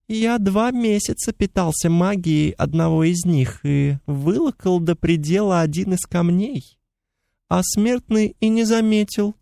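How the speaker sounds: high, thin mocking fairy voice; chittering pixie. A narrator's reading. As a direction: tease in a tiny insect voice. Pixie creature voice held.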